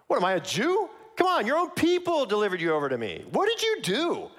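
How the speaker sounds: high-pitched, incredulous retort; attitude. Acted. Pilate answering the prisoner Jesus at the trial, with attitude. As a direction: throw the questions back with attitude. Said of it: "Pilate's gonna have some attitude when he responds here".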